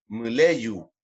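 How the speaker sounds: "Muleyu" closing on a rounded U; final W as U. Shown as a demonstration. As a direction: let "Muleyu" end in a clear U. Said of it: "en este caso más como una U".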